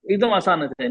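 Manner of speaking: normal talking voice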